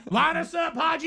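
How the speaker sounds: high, hammed-up order to line up; mock crew order. Mimicking gate crew lining up passengers, mock high call.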